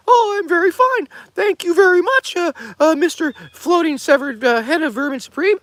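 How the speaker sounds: very high, loud cartoon squeak; squeaky pipe voice. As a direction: chirp back politely as the tiny glass head.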